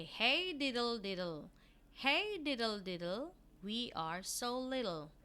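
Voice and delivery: teacher reading the nursery rhyme aloud to pupils. Acted. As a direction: read it out bright for young pupils.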